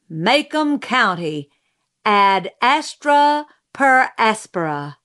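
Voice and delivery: slow, grand oration; declaiming. A narrator's reading. Mrs. Merriweather at her lectern to the audience, declaiming. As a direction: proclaim a motto to the whole hall.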